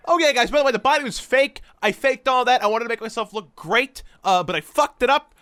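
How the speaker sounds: cheesy vlog confession voice; mock YouTuber. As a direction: imitate a breezy YouTuber owning up. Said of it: "And then it's like".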